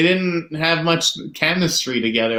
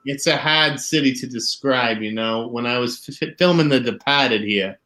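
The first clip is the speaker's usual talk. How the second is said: put-on Boston accent; 2 semitones lower than usual. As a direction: talk like a Boston movie character. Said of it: "anyone ever talks like that".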